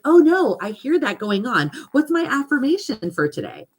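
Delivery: high-pitched mock alarm; acted self-talk. Catching your own negative self-talk, mock alarmed. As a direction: catch yourself with a high, lively oh no. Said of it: "you're gonna be like".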